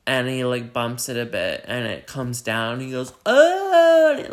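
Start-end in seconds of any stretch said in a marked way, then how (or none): 3.2-4.1 high, loud cry of alarm; sudden high-pitched shout; imitated yelp. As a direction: yelp as if pots are falling on you. Pots falling on robber.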